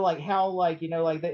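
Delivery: ordinary talk, nothing marked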